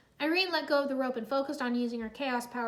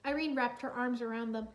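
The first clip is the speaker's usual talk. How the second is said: usual speaking voice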